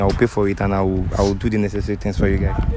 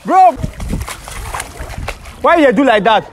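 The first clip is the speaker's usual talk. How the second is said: angry shout; 14 semitones higher than usual. Acted. Shouting at his friend after the fake shooting, angry. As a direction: yell it at him, furious. Prank victim confronts the prankster.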